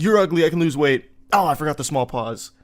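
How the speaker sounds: mock flustered comeback read from memory; awkward comeback. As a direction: recite the lines like a flustered student. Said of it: "you're like".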